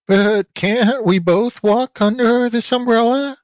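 high, slow goat bleat; bleating goat lady. A narrator's reading. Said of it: "bleated Aunt Letty".

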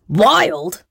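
shrieked. A narrator's reading. Pirate captain to the flower thief, shrieking. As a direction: shriek it like an outraged storybook captain. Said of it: "shrieked Tomb".

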